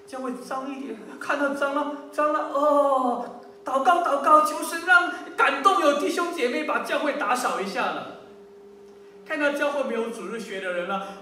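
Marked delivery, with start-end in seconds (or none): 1.2-8.2 higher, louder mock prayer; overdone plea to God, pitched up; mock pious plea. Preacher mimics members praying instead of cleaning, mock pious.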